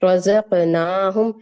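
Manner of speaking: रज़क़नाहुम loud and slow, long ना; two-count vowel. Shown as a demonstration. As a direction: recite रज़क़नाहुम out firmly, holding ना two beats. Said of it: "दो हरकत तक लेके जाएं".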